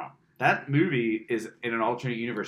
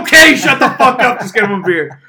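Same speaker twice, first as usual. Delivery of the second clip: much higher and louder, fed-up yell; mock exasperated shout; 10 semitones higher, 16 dB louder than usual. Friend yelling to just get him a beer, hammed-up outburst. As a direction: bark it out like you've lost all patience. Mock rant at bartender scene.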